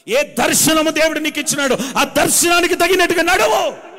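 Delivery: rising to a loud, high preaching climax; preaching at full force. Acted. Preacher urging the church to walk in their calling, building to full force. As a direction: drive the call home to the congregation.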